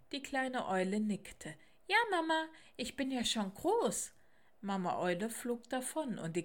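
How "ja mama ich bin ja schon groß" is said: put-on high-pitched, eager little child voice; little owl child. Little owl reassures Mama before she flies off, childlike. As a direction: answer like a small child, pitched up.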